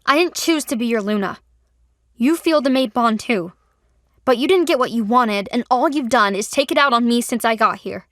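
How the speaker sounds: loud, heated rant; angry outburst. A narrator's reading. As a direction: let the anger pour out. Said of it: "Trinity was seething".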